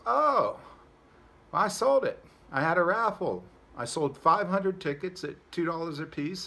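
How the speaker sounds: city slicker bragging to the farmer, pleased; pleased boast. Retold or imitated. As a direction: crow about the clever raffle. City fellow boasting about raffle trick.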